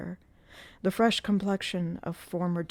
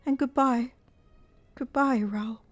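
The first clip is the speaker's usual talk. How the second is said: sad farewell; 7 semitones higher than usual. Acted. Christine's farewell to Raoul.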